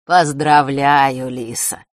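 slow, kind congratulations; friendly soft tone. A narrator's reading. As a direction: say it warmly and gently. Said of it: "мягким дружелюбным тоном".

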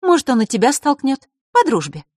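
put-on high-pitched snide girl's jab; malicious mock suggestion. A narrator's reading. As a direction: needle him in a high, sly voice. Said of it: "ехидно отозвалась Василиса".

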